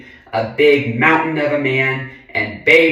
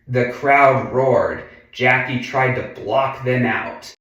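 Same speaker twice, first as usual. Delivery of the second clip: ordinary delivery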